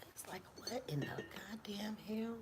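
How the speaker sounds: real whisper so the neighbor won't hear; hushed whisper behind the door. Real, not acted. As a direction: whisper it, keeping hidden. Neighbor outside her door.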